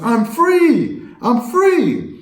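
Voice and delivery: high-pitched mock cry of joy; jubilant. Sports host mimicking player's reaction to his release, jubilant. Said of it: "he jumps up when he sees the news".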